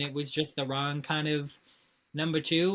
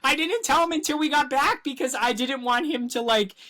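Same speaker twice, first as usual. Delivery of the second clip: much higher, laughing explanation; excited high telling; 8 semitones higher, 4 dB louder than usual. Real, not acted. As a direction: rush it out high and giddy.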